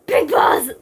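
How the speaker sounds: loud, high, rough-edged shout. Acted. Snake calling out to Big Boss, shouted. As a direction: cry it out forcefully. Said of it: "Snake shouted".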